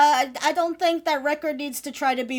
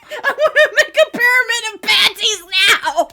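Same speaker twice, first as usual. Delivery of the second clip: loud laughing declaration; laughing shout; 7 semitones higher, 9 dB louder than usual. Real, not acted. Laughing demand.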